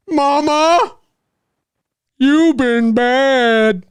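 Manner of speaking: very high, slow dragged-out Sloth voice; Sloth impression. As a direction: stretch each word slow and high like Sloth. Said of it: "a sloth impression".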